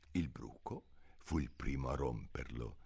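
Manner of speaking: soft whispered narration. A narrator's reading. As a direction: whisper to keep the hush. Narratore dopo il silenzio.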